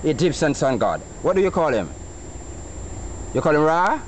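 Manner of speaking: ordinary talk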